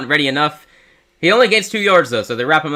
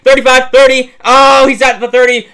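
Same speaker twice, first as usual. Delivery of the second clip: fast breathless return call, much higher and louder; live play-by-play; 11 semitones higher, 8 dB louder than usual. Real, not acted. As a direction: build the call as he breaks free. Calls punt return live.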